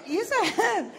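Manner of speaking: comic loud yes I am; loud mock insistence. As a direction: insist loudly, pitch up. Insisting she is fat, loud.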